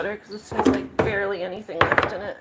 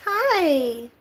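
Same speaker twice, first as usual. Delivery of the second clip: much higher sing-song hello to the cat; high pet talk; 6 semitones higher, 8 dB louder than usual. Real, not acted. Talking to her cat, a much higher cooing hello.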